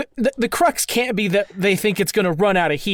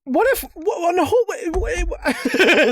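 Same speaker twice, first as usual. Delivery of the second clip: much higher, tripping over words; excited stammer; 8 semitones higher than usual. Real, not acted. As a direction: burst in, too eager to finish.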